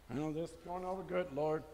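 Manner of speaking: a fast, soft, dry muttered aside; a wry aside to God. Acted. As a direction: toss it off under your breath.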